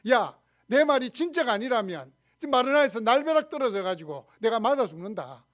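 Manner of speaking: loud, emphatic swearing on one's life; self-cursing oath. Acted. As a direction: act out a vehement oath against yourself. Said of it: "자신을 저주하는 거잖아요". (자신을 걸고 큰 소리로 저주하듯 맹세)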